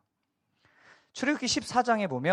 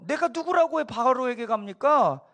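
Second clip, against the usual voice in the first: higher, louder, slow sarcastic protest; imitating a reluctant, sneering refusal; 7 semitones higher, 5 dB louder than usual. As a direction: drag out the objection with a mocking edge. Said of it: "약간 비꼬면서".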